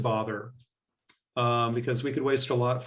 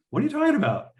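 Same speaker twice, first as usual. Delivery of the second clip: much higher, louder, incredulous question; baffled; 5 dB louder, faster than usual. Retold or imitated. John answers the neighbour's conspiracy question, incredulous. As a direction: react as if the idea is absurd.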